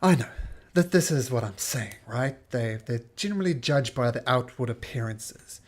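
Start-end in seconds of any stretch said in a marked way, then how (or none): none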